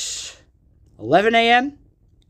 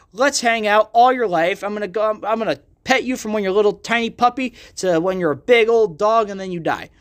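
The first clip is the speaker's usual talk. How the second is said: ordinary delivery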